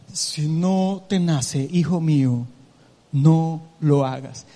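slow, deep, booming voice of God; a solemn, deep divine voice; mock voice of God. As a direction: intone it like God speaking from heaven, slowly. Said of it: "Nunca Dios te va a decir".

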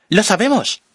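high, loud cry; shout. A narrator's reading. Marla shouts back to the narrator, high and loud. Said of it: "Marla grita".

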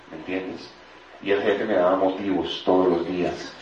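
normal talking voice

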